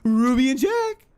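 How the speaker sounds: high, loud call to dogs. Pretend. Streamer hailing a viewer's dogs, high loud calling. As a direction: call out brightly for someone's pets. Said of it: "I was calling out someone's dog".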